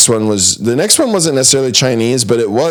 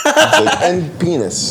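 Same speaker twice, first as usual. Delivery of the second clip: laughing; 7 semitones higher, slower than usual. Real, not acted. Laughing mock quote.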